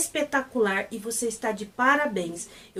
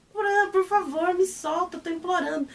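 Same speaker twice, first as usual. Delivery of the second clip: mock pleading; 7 semitones higher than usual. Pet begs Pran to let him go, mock pleading. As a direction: playfully beg to be let go. Pinned down, begging to be released.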